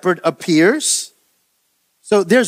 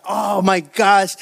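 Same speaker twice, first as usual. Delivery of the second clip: ordinary delivery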